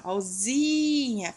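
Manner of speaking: "ozinha" with "zi" drawn out; stretched syllable. Shown as a demonstration. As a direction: hold "zi" long in "ozinha". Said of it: "É a penúltima".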